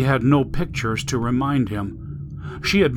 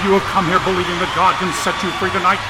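raised, fast revival preaching; booming preacher; 5 semitones higher, faster than usual. A narrator's reading. Preacher rousing thousands over a speaker system, booming. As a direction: work the crowd like a revival preacher. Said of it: "a man's powerful voice boomed out over a speaker system".

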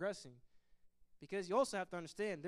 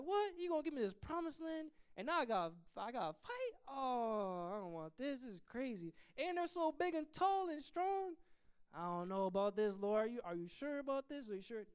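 high, whiny, disbelieving protest; fearful doubter; 6 semitones higher than usual. Retold or imitated. Preacher voicing a believer balking at God's command, high whiny protest. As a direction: balk at the fight, panicking. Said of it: "You're like".

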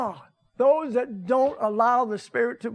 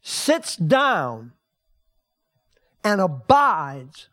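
drawn-out scripture reading; slow, stressed; 2 semitones lower, much slower than usual. Shown as a demonstration. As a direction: linger on every word. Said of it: "this is what I like".

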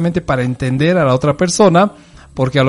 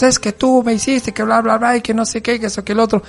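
higher, whiny accusing partner voice; mock complaint; 8 semitones higher than usual. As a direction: rattle off the grievances in a high mock whine. Partner arrives complaining, acted quote.

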